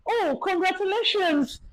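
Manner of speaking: high, soft and slow, like a sweet announcement; cheerful doctor. Retold or imitated. Retelling how the doctor told her of twins, high soft and slow. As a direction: sing the good news gently.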